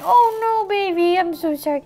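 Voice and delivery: high cooing fuss, easing down; mock distressed apology. Toy owner apologises to crying doll, high cooing distress. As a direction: fuss over the baby, starting high.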